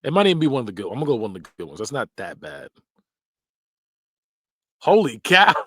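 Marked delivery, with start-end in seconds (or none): 4.8-5.5 high, loud holy cow; surprised exclamation. Real, not acted. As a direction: blurt it out in surprise. Reacting to silly phrase.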